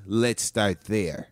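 put-on slow [name]-style accent on "Let's start there"; mock [name] accent. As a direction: stretch it out like [name]. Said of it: "She says".

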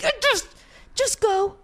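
very high-pitched strained urging; hammed-up frantic dismissal; retold desperate exasperation. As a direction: beg them out, at the end of patience. Acts out desperate order to leave.

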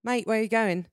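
playful put-on British accent; amateur British impression. Shown as a demonstration. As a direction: do a cheeky British "mate". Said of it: "I can't stop doing the British accent".

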